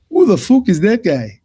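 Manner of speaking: loud, high mock Irish brag; Conor McGregor impression. Mocking McGregor's taunt at Jeremy Stevens, a loud, high, hammed-up jeer. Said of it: "That whole episode with Conor McGregor".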